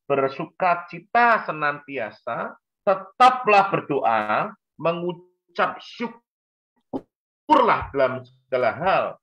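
slow, drawn-out, rising emphasis on each command; emphatic scripture quote. Acted. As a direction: proclaim each command slowly. Quoting scripture to congregation. (mengutip firman dengan tegas dan lambat)

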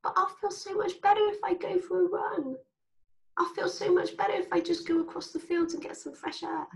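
a little inner coach nudging you out for a run; high, light voice of reason, encouraging; tiny sensible voice. Retold or imitated. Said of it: "There's a tiny little voice that goes".

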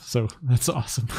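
chuckles through the words; a giggle breaking into the line; laughing. Real, not acted. Giggling about free stuff.

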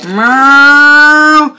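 a high, loud, drawn-out meow; cat meow. Acted. Tractor Boy answers his radio call with a loud meow.

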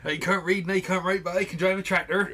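joining in a West Country farmer impression; David Prowse. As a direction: play up the rural West Country accent. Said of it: "that's what he sounded like".

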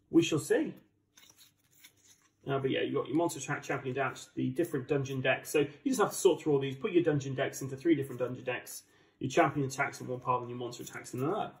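ordinary talk, nothing marked

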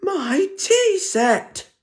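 very high, slow squeaky gran; squeaked. Acted. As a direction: squeak like a shocked old lady. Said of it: "squeaked Gran".

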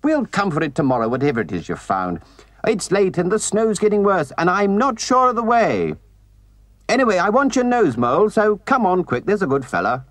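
calling back. A narrator's reading. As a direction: briskly chivvy a friend along. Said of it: "he called back".

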